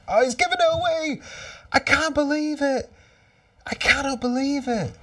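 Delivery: high, loud cry of real disbelief; fan's anguished shout as the ball is given away; disbelieving cry. Real, not acted. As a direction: wail at the lost chance. Chance wasted, fan in despair.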